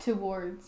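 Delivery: "Towards" said as too-WARDS; imitated pronunciation. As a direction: give "Towards" a clear oo in the first syllable. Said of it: "That's how she says".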